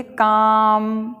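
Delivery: "काम" with the आ drawn out; long आ. Shown as a demonstration. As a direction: stretch the vowel in "काम", L silent. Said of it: "लंबा आ चलता है". ("काम" में लंबा खिंचा आ)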